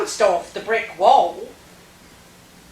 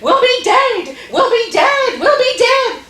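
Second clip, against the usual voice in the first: very high, loud mimicked children's wail; children calling out; 13 semitones higher, 6 dB louder than usual. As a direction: imitate little ones crying out in panic. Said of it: "all their little ones call out".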